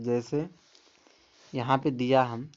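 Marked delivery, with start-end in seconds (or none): none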